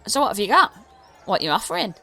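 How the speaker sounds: high, fast goblin pitch; goblin trader. Acted. Goblin offering the party a trade, high and fast. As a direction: rattle it off like a pushy little goblin. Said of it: "so he's like".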